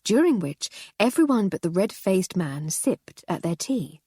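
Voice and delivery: ordinary delivery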